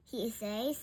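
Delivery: usual speaking voice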